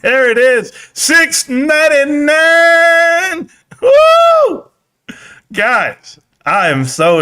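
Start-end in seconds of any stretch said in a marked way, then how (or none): 0.9-3.5 slow, high excited call; excited drawn-out call. Real, not acted. Cheering as the price hits a number. 3.8-4.7 a high, loud whoop of joy; real excited yell; excited woo. Real, not acted. Cheering as the stock climbs.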